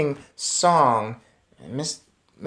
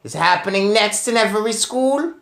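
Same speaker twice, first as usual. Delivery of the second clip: high, loud witch voice with a rising question; Grand High Witch; 6 semitones higher, 6 dB louder than usual. Acted. Witch leader quizzing her eager audience, loud and high. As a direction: tease the crowd like a gloating witch. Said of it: "shouted the Grand High Witch".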